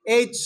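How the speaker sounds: teacher demonstrating এইচ alone; model letter sound. Shown as a demonstration. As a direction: clear teacher demo of এইচ. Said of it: "শুধু স্যারকে শুনবা".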